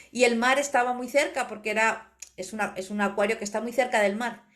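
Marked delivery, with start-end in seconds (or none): none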